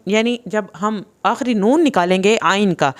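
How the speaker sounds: ordinary talk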